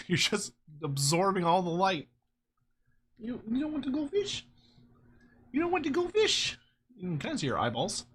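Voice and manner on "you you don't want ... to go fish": high cooing questions to the dog. Real, not acted. Asking the dog in high baby talk about fish. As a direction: tease her sweetly up high.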